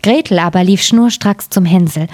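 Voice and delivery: plain reading voice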